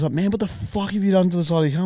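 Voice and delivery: ordinary talk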